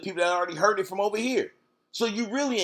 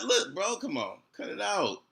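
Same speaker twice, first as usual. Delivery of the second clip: ordinary talk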